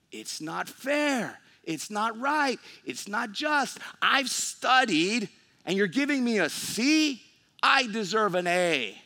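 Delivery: whiny complaint. Acted. Day laborers complain to the owner, loud and indignant.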